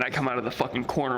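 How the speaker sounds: ordinary talk, nothing marked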